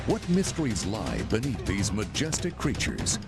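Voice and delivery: ordinary talk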